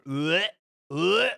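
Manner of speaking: short retching noise; disgusted "blech". Real, not acted. Player reacting to a hated mod, disgusted blech.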